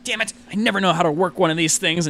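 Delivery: put-on high, exasperated old woman's outburst; frustrated old lady. Old lady pressing buttons on her makeup compact, frustrated outburst. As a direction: curse loudly, then rush the complaint. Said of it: "like, furiously all the buttons".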